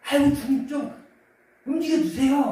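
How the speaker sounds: high, loud exasperated whine; disgruntled plea. Retold or imitated. Disciples waking Jesus in the storm, disgruntled whining. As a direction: wheedle him awake, fed up. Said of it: "이런 느낌의 뉘앙스로 주님을 깨우고".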